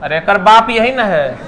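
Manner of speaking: normal talking voice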